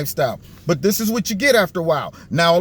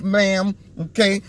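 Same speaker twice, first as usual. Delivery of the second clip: ordinary talk, nothing marked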